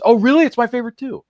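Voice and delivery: excited. Retold or imitated. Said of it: "He says".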